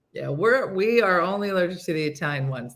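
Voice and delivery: ordinary talk, nothing marked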